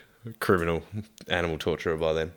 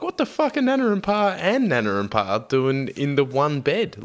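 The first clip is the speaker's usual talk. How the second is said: incredulous; 7 semitones higher than usual. Real, not acted. Incredulous rant about grandparents' bed.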